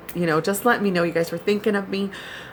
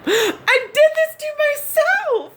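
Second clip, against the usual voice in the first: mock yell; slower than usual. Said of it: "I yell out of the bathroom".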